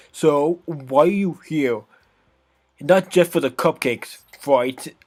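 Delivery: put-on sassy bratty girl, scornful. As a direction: scoff like a bratty tsundere. Natsuki voice.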